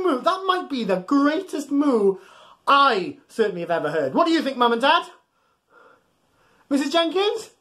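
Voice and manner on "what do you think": plain reading voice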